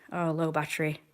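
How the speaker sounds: usual speaking voice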